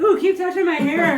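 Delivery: high, loud, laughing and slow; laughing mock delight. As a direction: purr it through laughter. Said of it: "and I'm like".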